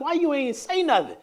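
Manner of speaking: exasperated. Retold or imitated. Retold exasperated question.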